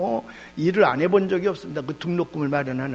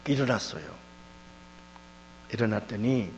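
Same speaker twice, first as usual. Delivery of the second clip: usual speaking voice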